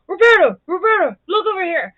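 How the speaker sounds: loud, high imitated call, praise at full volume; calling out across the room. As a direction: stand up and announce her proudly to the manager. Regular stands up, calls the manager.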